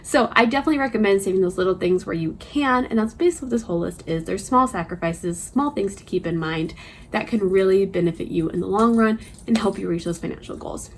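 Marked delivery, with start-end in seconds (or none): none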